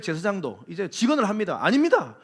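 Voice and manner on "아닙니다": louder, higher earnest protest; pleading protest. Acted. The priest protesting to the king, earnest and loud. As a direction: plead hard in the priest's defence. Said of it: "막 항변합니다".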